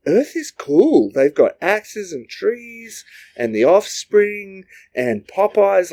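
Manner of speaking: a chill alien who loves Earth, up high and slow; laid-back alien. Acted. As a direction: float it up high like a blissed-out alien. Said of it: "another group that was like".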